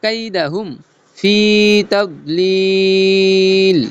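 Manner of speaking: slow chanted phrases with breaks between; chopped-phrase recitation. Shown as a demonstration. Teacher modelling the verse phrase by phrase, slow chopped recitation. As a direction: recite it in cut pieces, drawing out the long vowels. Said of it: "কাটা কাটা সুরে বলবো".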